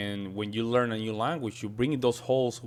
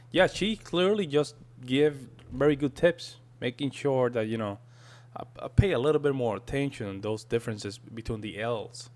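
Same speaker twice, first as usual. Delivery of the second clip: ordinary talk, nothing marked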